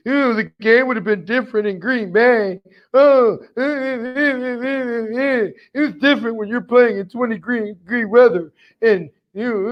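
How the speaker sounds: high, whiny sore-loser voice with stammering "eh, eh"; mock him as a little girl who can't accept losing; whiny Aaron Rodgers. Fan mocking Aaron Rodgers after the playoff loss, high whiny sore-loser voice. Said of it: "That's exactly how he sounded. Like a little girl".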